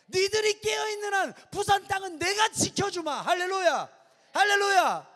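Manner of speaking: starts level, grows into a loud high shout; building to shouted promise. Acted. Preacher voices God's promise to youth, building to shout. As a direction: swell from promise into a roaring shout.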